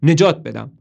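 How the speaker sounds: loud, irritated insistence; still exasperated. A narrator's reading. Toby insisting to Marilyn he is saving them, irritated and loud. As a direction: push the words out in anger. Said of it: "همچنان با حرص میگه".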